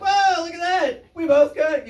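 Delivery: high, excited and impressed; impressed whoa. Retold or imitated. Comic impressed by the approaching couple, high excited whoa. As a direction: marvel at the couple, pitch way up.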